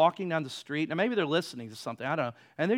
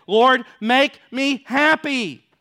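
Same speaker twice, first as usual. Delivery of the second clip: mock prayer; 9 semitones higher, 14 dB louder, slower than usual. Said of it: "Sometimes we think".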